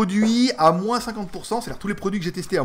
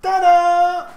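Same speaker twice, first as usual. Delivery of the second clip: excited reveal; 12 semitones higher, 8 dB louder than usual. Real, not acted. Big reveal of package.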